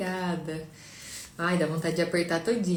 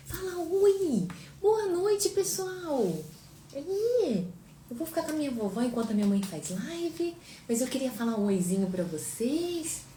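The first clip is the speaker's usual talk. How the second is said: put-on much higher, cute baby voice speaking as the daughter; voicing the baby; 8 semitones higher than usual. Mother speaking as baby Sofia to the viewers, high cute voice.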